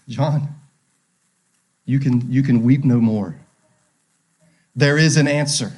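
low, gentle consoling voice; tender reassurance. Acted. Elder comforting the weeping John, tender and low. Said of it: "I love the tenderness of the Lord".

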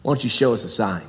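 mock-respectful, low and soft; sarcastic. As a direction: sneer the question like you don't mean teacher. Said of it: "even that's sarcastic".

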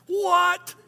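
high-pitched, incredulous mock reaction; imitated astonishment. Listeners hearing of a child in diapers, imitated astonishment. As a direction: imitate a stunned listener, voice shooting up.

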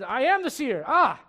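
excited reveal. Acted. Dramatic reveal of the seer.

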